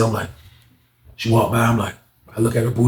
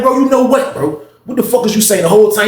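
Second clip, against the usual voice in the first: high-pitched exasperated challenge; heated confrontation; 6 semitones higher than usual. Acted. Storyteller replaying his argument with the little dude, heated. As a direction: demand an answer, pitched up. Acts out confrontation before a fight.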